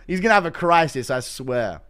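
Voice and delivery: usual speaking voice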